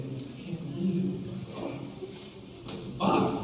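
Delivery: breathy, slow stunned murmur; almost whispering it, let down; hushed disbelief. Retold or imitated. Disbelief at dad's boxy car.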